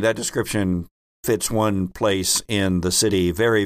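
ordinary talk, nothing marked

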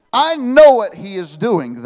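normal talking voice

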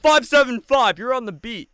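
mock hype announcer. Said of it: "as soon as it said".

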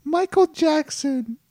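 loud, very high falsetto; squeaky son character. Acted. Son naming his dream parent, loud squeaky falsetto. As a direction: squeal the name as a big reveal.

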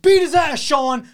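high mock yell of an angry old dad. Dad in passenger seat urging son on, high yelling. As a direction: bellow like the furious passenger dad.

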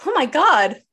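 high, loud startled cry; retold shock at a figure behind her; shocked gasp. Retelling her scared reaction.

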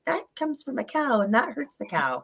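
little son's voice. Retold or imitated.

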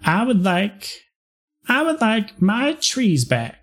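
high, slow Southern belle plea. Acted. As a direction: ask for the trees back like a Southern belle. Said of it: "I'll just be a Southern belle".

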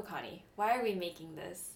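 ordinary delivery

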